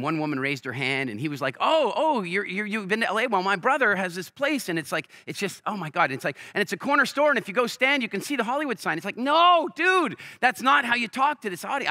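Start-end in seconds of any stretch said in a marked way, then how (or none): 1.6-4.7 high, loud, over-friendly salesman schmooze; pushy salesman impression. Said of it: "you don't talk like that at A A". 6.5-9.0 high, loud, over-friendly salesman schmooze; pushy salesman impression. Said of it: "you don't talk like that at A A". 9.2-11.9 high, loud, pained no, dude; his own inner voice, cringing at the salesman's speech, high and loud; cringing exasperation. Retold or imitated. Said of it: "I was cringing".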